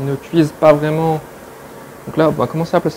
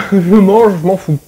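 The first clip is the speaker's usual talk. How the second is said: laughing; 5 semitones higher, 9 dB louder than usual. Real, not acted. Laughing, starving after cooking.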